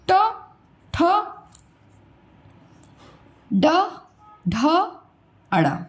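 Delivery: ट, ठ, ड, ढ, अड़ा एक-एक करके जीभ मोड़कर; हर वर्ण अलग, मूर्धा से साफ़ उच्चारण; retroflex letters demo. Shown as a demonstration. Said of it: "ड, मूर्धा स्थान". (मूर्धन्य वर्ण धीरे-धीरे बोलना)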